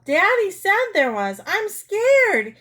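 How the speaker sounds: very high, loud scared squeal; hammed-up frightened shriek. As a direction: squeal in terror, ending in a long eee. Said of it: "but continued to squeal".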